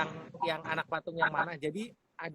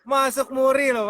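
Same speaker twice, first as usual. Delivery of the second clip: higher, much louder excited boast; 6 semitones higher, 11 dB louder than usual. Real, not acted. Guest boasting to Pak Tung about a MURI record, excited and loud. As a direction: announce the record proudly.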